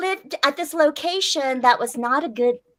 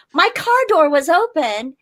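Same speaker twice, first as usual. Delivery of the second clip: ordinary delivery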